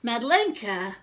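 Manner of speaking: ordinary delivery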